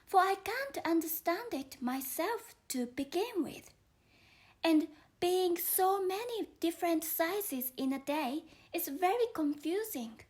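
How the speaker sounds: young Alice explaining herself to the caterpillar, lightly voiced.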